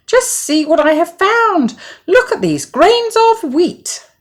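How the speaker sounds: high, loud, eager hen voice; excited little hen. Acted. Hen shows her neighbours the wheat, high and eager. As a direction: show off the find, bright and high. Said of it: "said the little red hen".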